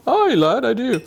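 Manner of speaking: mock northern accent. Answers in another put-on voice.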